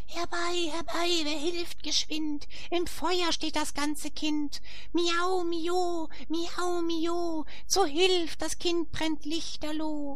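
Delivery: very high, crying-out cat voices; wailing cats. A narrator's reading. The cats crying for help, high wailing cat voice. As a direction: call desperately for help as the cats. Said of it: "die schreien, gar jämmerlich zu zweien".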